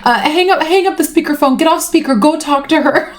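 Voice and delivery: high, fussing imitation of a husband; imitating friend's husband. Husband telling them to get off speakerphone, mock-flustered. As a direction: pitch it way up, stumbling over the instructions. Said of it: "her best friend's husband is like".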